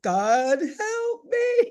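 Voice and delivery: high, drawn-out cry for help; hammed-up desperate cry to God; desperate plea, retold. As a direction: wail it upward like a panicked prayer. Retold desperate prayer in danger.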